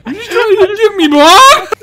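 high, loud hard-man challenge; glasgow street accent. Pretend. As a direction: confront a pickpocket, Glasgow style. Acting the street confrontation.

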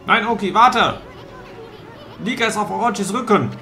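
high, loud urgent cry; alarmed shout. Acted. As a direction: shout to stop him. Companion cries out to stop.

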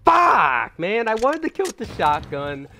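loud high curse, then high-pitched whining complaint; frustrated outburst. Real, not acted. Gamer robbed of a shotgun kill, frustrated high outburst. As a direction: cry out in real frustration.

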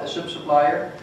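ordinary talk, nothing marked

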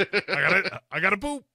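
high, loud comic catchphrase; Duncan. Retold or imitated. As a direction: blurt it as a silly catchphrase. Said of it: "as Duncan says".

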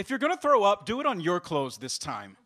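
put-on high, loud child's jab, easing off at the end; bratty little sister. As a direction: chime in to scold your brother. Younger sister chimes in, scolding brother.

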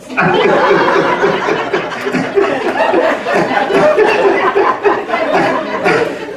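a long, high, breathy fit of laughter. Real, not acted. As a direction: laugh hard and long at the punchline. Long laugh after counseling punchline.

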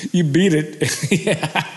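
real laughter breaking into the words; laughing. Real, not acted. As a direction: laugh through the punchline. Laughing at [name]'s hair joke.

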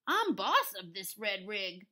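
loud, domineering cow voice; Bossy Cow. Acted. Cow claims the truck, loud and bossy. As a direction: lay down the law loudly. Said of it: "said Bossy Cow".

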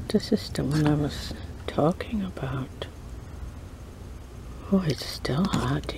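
whispering. Real, not acted. Whispered eating vlog aside.

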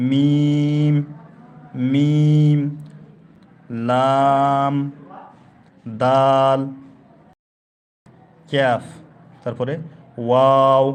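each Arabic letter called out loud and held; loud classroom drill of letter names; loud letter recitation. Shown as a demonstration. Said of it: "এভাবে আমাদেরকে এটি উচ্চারণ করে নিতে হবে".

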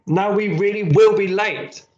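high, exasperated scolding; cross. A narrator's reading. Mum to Billy, late for school, cross. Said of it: "said Mum crossly".

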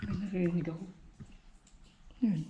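hushed, slow whisper. Real, not acted. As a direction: breathe it out, scared of the noise. Hears noise in cave, scared.

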